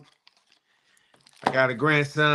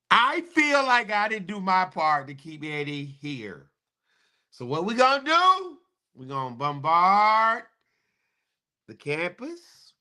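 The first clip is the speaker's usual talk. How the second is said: high, hammed-up call to arms; rally the alumni in jest, pitched up; joking battle cry about storming the campus; mock rallying cry; 9 semitones higher than usual. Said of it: "I'm just joking this morning".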